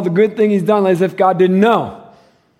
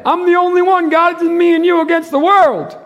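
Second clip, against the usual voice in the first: high, loud whiny self-pity; mock Elijah's self-importance; 11 semitones higher, 5 dB louder than usual. Pastor mimics Elijah boasting to God, whiny and loud. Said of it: "Look what Elijah did".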